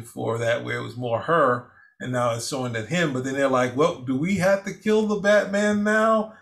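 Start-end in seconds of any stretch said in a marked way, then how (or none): 3.8-6.3 raised, pitched-up mock alarm; mock alarmed question. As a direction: play it as a startled, teasing question. Mock voice of the film's characters.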